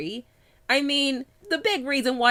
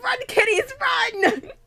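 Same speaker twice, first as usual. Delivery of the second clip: panicked shout; 13 semitones higher, slower than usual. Acted. Mock-panicked warning to kids.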